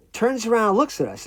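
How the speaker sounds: plain reading voice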